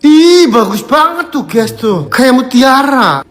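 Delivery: excited gushing. Real, not acted. Admiring the pearls, gushing.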